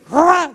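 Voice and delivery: high, loud rasped "ra"; imitated flourish. Son opens up the money for mother, high loud flourish noise. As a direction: make a sudden showy swoosh noise.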